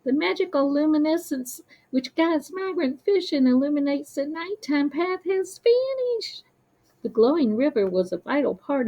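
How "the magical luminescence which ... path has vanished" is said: nervous. Acted. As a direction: tell the bad news anxiously. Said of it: "lights flickering nervously".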